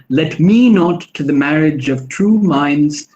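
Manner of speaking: slow "Let me not to the marriage of true minds" with me, marriage, true minds stressed; iambic stresses. Shown as a demonstration. Lecturer scanning the opening line for the students. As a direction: read the line slowly, leaning on each stress. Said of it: "The stressed syllables are me, true minds, marriage".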